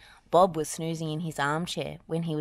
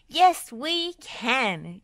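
drawn-out, high rallying yell; long-held cheering reply; 8 semitones higher, 4 dB louder, much slower than usual. A narrator's reading. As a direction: answer slowly and high, like a team chant. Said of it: "yelled the team".

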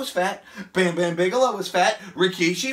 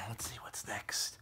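slow, soft whisper; tired murmur under the breath; 11 semitones lower, 19 dB quieter, slower than usual. Real, not acted. Tired after exercise.

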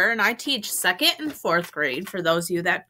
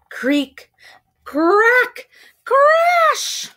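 rising from a spoken creak to a high shout; building crash; 7 dB louder, much slower than usual. Acted. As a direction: build each word louder and higher to a shriek. House breaking, building sound words.

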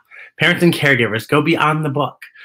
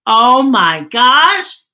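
loud, high, drawn-out gasp of delight; thrilled, slow, much louder cry; excited exclaim; 10 semitones higher, 7 dB louder, much slower than usual. Real, not acted. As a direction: burst out with joy at the page. Reacting to the lion mask.

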